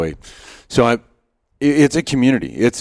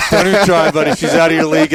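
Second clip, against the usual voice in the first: louder, higher, laughing through the repeat; 7 semitones higher, 5 dB louder, faster than usual. Retold or imitated. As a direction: crack up while repeating the buddy's warning. Repeating buddy's line laughing.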